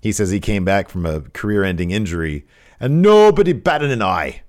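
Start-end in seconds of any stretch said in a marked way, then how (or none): 2.8-4.4 loud, high, bad British complaint; bad British Gradwell voice. Wrestler aggrieved at being ignored, bad British outburst. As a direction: protest it in a hammy British accent. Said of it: "my Gradwell voice".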